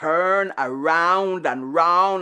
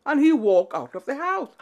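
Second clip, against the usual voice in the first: usual speaking voice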